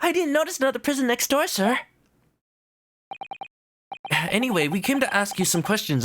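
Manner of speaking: quick polite reply; lightly voiced lawyer.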